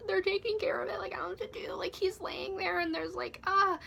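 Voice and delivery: high-pitched, fretful retold panic; panicked. Calling a friend back about the man lying there, panicked.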